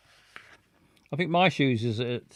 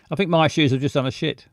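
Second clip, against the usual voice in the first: usual speaking voice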